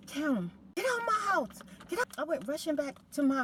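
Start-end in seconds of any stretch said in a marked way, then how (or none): 0.1-2.1 much higher, shouted command to leave; angry order. Retold or imitated. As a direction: throw her out of the house. Throwing neighbour out.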